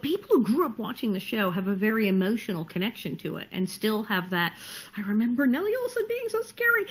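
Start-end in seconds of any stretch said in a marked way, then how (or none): 5.0-6.8 much higher, wide-eyed fan voice; awed fan. Retold or imitated. Grown fan recalling the scary TV villain, awed. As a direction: gush like a spooked childhood fan.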